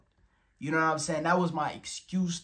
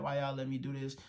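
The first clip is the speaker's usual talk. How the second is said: usual speaking voice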